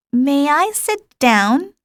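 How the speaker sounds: a touch of timidity; timid inquiry. A narrator's reading. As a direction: ask shyly like a small boy. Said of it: "a timid inquiry".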